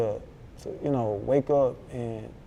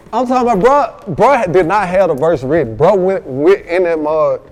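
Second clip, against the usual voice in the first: loud, raised, emphatic retelling; excited; 4 semitones higher, 9 dB louder than usual. Real, not acted. Rapper hyped telling how his cousin laid the verse, loud. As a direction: hype up how fast he was.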